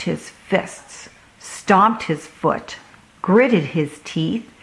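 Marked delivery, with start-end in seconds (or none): none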